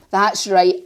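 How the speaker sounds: ordinary delivery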